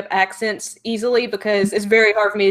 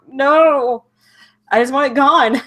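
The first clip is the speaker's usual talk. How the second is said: higher, drawn-out wail of protest; mock despair; 4 semitones higher, 4 dB louder, slower than usual. Speaker fed up with a lingering accent, mock despair. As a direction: whine it in playful exasperation.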